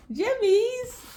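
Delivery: high, loud squeal of Jimmies; giddy shout-out to the Gemini viewers; excited playful call. Real, not acted. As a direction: squeal the nickname out to the fans.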